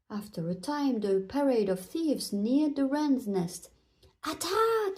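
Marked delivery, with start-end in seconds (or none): 4.2-5.0 high-pitched battle cry; storybook queen's rallying shout. A narrator's reading. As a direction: shout the order to charge. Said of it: "shouted the fairy queen".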